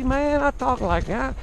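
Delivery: playful backwoods Southern accent. Shown as a demonstration. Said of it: "the southern redneck comes out in me".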